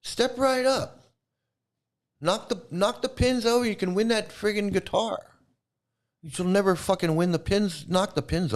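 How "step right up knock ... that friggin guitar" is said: high-pitched mock barker's pitch; carnival barker. Mocking preacher as fairground huckster, carnival barker. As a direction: call out a sideshow come-on, mockingly. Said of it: "It's like a carnival barker".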